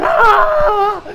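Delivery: high, loud wailing scream; crying scream. Acted. Diavolo wailing in pain as the saw cuts him. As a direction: wail while sawn apart. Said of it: "He's screaming out there".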